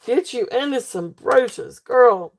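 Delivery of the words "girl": usual speaking voice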